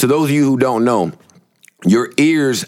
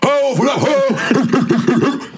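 playful shout; 13 semitones higher, much slower than usual. Real, not acted.

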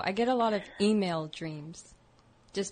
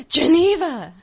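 loud gasping name, drawn out; surprised gasp; 9 semitones higher, 11 dB louder than usual. Real, not acted. Gasps at caller's name.

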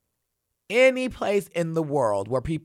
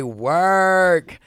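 drawn-out hype; 2 semitones higher, 5 dB louder than usual. Real, not acted. Hyped reaction mid-letter.

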